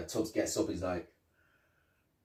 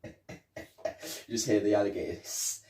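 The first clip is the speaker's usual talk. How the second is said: ordinary talk, nothing marked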